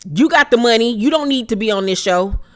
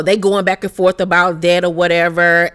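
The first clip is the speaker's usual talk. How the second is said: ordinary talk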